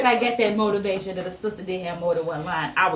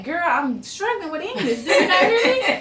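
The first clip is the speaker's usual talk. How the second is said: giggling disbelief, pitched way up; laughing, high protest; 9 semitones higher than usual. Retold or imitated. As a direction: squeal it through laughter, much higher. Retold reply to voice advice.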